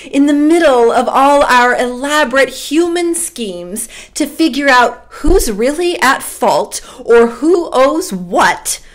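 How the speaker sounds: ordinary talk, nothing marked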